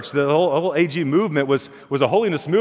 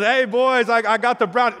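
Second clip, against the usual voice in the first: put-on higher, sing-song mom calling boys; bright hostess call, pitched up; chirpy mom; 7 semitones higher than usual. Mom brings brownies.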